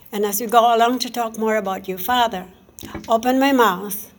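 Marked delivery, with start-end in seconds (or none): none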